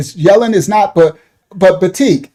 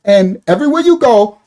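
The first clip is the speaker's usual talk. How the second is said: usual speaking voice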